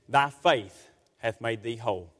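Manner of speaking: low, slow and grave, like scripture spoken by Jesus; a lordly pronouncement, deep and unhurried; solemn voice of Jesus. Acted. Preacher voicing Jesus' KJV words.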